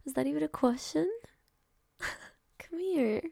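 high, emotional, overjoyed; tearful squealing yes. Acted. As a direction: squeal with joy, close to tears. Accepting a marriage proposal.

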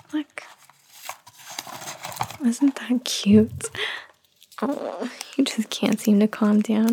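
put-on soft hushed whisper to a crying baby; calm her with a gentle whisper; soothing a child.

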